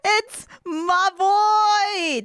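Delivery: slow, high, thrilled; drawn-out excited cry. Real, not acted. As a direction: stretch it out with glee. Excited reaction to favorite character.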